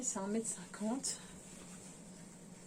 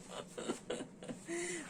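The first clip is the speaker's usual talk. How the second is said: high giggle; 8 semitones higher, 8 dB quieter than usual. Real, not acted. Laughs at her own joke.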